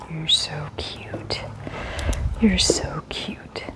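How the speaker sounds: very slow, soft whisper; whispered praise. Real, not acted. Trainer praising the dog lying down, in a slow whisper. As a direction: murmur tender praise so he stays calm.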